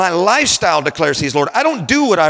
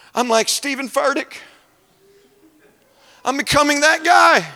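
high-pitched, incredulous self-quote; mock dismay; 9 semitones higher than usual. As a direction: act out alarm at what you are becoming. Acts out dismay at turning charismatic.

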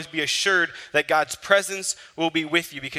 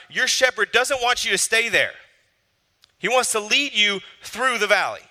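rising, louder preacher's cry; preaching at full force; 7 semitones higher, 5 dB louder than usual. Acted. Preacher urging the hurting congregation, loud and rising. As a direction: drive the point home to the congregation.

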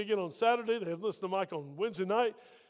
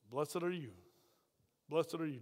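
tender blessing; 5 semitones lower, 8 dB quieter than usual. Retold or imitated. Quoting Jesus tenderly.

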